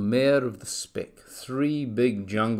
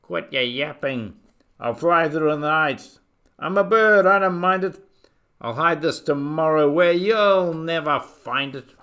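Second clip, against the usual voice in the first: raised, higher voice of a defiant eagle; calling back; 4 semitones higher, 7 dB louder than usual. A narrator's reading. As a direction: call it over a shoulder, building louder. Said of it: "over his shoulder he called back".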